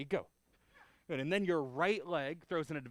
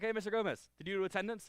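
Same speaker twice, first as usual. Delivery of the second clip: raised voice to a colleague; calling out across the room; 6 semitones higher, 4 dB louder, faster than usual. Shown as a demonstration. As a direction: call over to the other instructor. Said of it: "be like".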